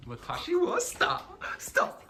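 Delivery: high, slow cartoonish protest; hammed-up high anime character; mock anime voice. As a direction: play-act a high-pitched anime plea. Playful put-on Japanese anime-style line.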